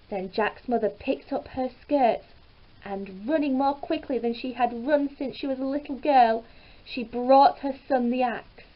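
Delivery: usual speaking voice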